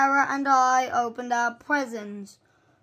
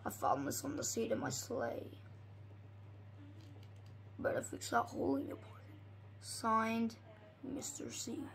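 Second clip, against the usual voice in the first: whispered note; 3 semitones lower, 15 dB quieter, faster than usual. Acted. Secret note read out.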